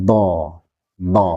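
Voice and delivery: "do" drawn out from the tongue edge; lengthened dhad. Shown as a demonstration. Teacher showing the mid tongue-edge letter, prolonged "do". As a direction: prolong each "do".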